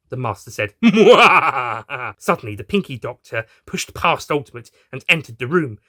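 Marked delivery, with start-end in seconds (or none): 0.8-2.1 loud, gloating cackle; the master's hammy evil laugh; evil villain laugh. Acted. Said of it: "the Master and Rani's evil laughs".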